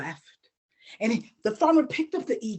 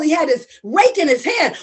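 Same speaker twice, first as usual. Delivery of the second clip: usual speaking voice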